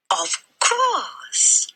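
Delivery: high, breathy librarian voice; a concerned lady asking gently; kindly librarian. A narrator's reading. Mrs Phelps voiced.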